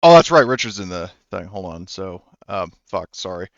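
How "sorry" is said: ordinary talk, nothing marked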